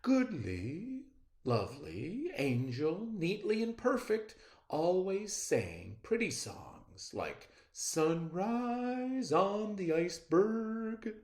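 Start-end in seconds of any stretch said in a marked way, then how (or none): none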